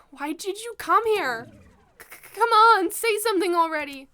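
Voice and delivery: high-pitched pleading demand; anxious demand. Acted. Nervous student begs someone in the dark to answer, anxious demand. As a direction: stammer into it, pushing for an answer.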